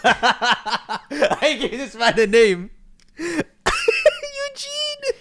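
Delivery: cracking up, voice shooting way up high; helpless laughter. Real, not acted. Host teasing friend about the name Eugene, helpless laughter. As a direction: squeal it out through loud, uncontrollable laughter.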